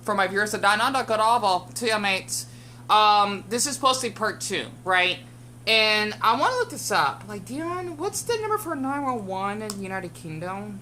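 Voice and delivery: ordinary talk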